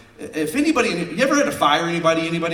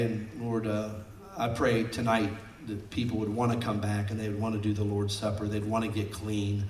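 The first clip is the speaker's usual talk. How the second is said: closing prayer; 4 semitones lower than usual. Acted.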